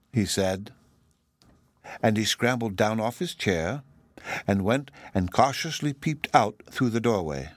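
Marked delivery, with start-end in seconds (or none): none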